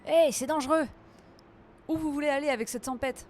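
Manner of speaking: mise en garde aiguë et forte; interpellation inquiète pour jouer. Pretend. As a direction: crie gare aux personnages, faussement alarmée. Interpelle les personnages en danger. (mise en garde faussement affolée)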